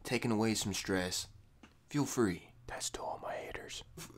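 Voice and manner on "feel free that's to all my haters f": whisper. Acted. Inviting haters to vent.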